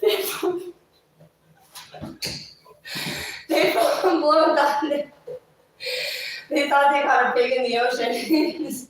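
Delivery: high, loud, laughing through the words; laughing while telling. Real, not acted. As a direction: barely get it out for laughing. Laughing through the pig punchline.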